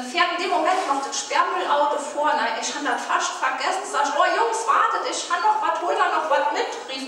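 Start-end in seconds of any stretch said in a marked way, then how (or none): 4.2-6.7 loud, higher shout to the truck crew; calling out. Retold or imitated. Yelling to the garbage men to wait, loud. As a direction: hail the bin men before they drive off.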